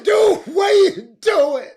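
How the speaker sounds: put-on high, loud holler at a boy on the court. Shouting at a boy who won't pass, high and loud. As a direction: yell it across the gym, slow and high. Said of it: "I hollered out".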